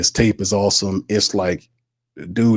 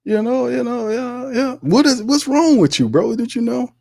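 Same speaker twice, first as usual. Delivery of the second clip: mock disbelief; 13 semitones higher than usual.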